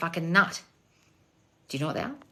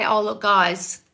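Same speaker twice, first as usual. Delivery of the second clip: ordinary talk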